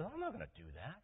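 indignant refusal, jumping high in pitch; acted huffy protest, voice leaping up; upset Naaman. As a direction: balk at it in acted disgust. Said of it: "Naaman got all upset".

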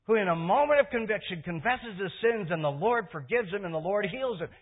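high, loud, aggrieved complaint; indignant protest. Retold or imitated. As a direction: rail against the unfairness. Pastor's inner protest retold.

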